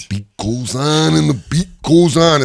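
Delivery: slow raspy chant; Macho Man impression. Macho Man's catchphrase chanted slowly and raspy. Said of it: "learned how to do the voice".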